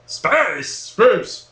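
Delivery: usual speaking voice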